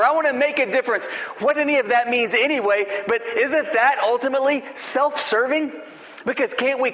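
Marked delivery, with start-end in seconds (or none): 0.0-1.1 higher and faster mocking sing-song; mocking silly talk. Preacher mimics churchgoers' grand claims, higher, quick and mocking. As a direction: rattle off a lofty boast in mockery. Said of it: "silly things like".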